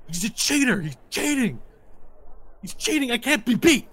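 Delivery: high-pitched whining accusations; squealing, petulant outrage; indignant sore loser. Acted. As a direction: protest shrilly that it's unfair.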